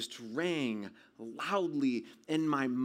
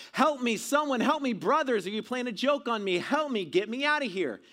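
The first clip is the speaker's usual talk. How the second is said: high, loud, pleading calls; yelling for help; 8 semitones higher, 5 dB louder than usual. Retold or imitated. Trapped boy calling to his brothers for rescue, yelling for help. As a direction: call out urgently to anyone in earshot. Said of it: "I kept screaming and yelling".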